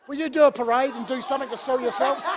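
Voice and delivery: ordinary talk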